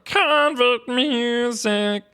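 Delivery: high, slow froggy sung voice. Retold or imitated. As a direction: draw out the first word like a croaking singer. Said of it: "my [name] impression".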